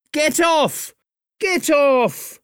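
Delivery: high, frantic yell at the flies; shouting. A narrator's reading. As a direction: shoo them off in a high panic. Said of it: "shouted Jim".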